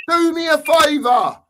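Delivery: real anger. Real, not acted. Fan losing his temper with his club, angrily shouted. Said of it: "it makes me so angry".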